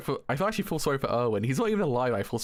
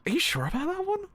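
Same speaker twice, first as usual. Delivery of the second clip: pitched way up, doubtful; high incredulous squeak. Retold or imitated. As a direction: squeak it out in disbelief. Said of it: "I'm like".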